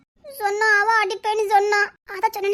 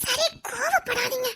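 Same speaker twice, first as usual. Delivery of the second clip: soft, slow, drawn-out wheedle; coaxing; 2 semitones higher, 5 dB quieter, slower than usual. Acted. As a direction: stretch it out softly to pacify him. Coaxing the angry man to relent. (கொஞ்சலாக இழுத்துச் சமாதானம்)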